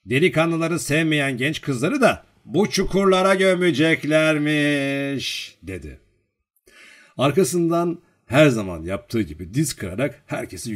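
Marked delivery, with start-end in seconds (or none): 0.1-5.6 high, loud, slowly drawn-out joke rumour; mock ominous teasing. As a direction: stretch out the punchline like a teasing joker. Joker spins a mock rumour.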